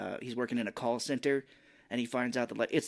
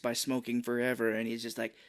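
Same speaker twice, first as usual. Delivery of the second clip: usual speaking voice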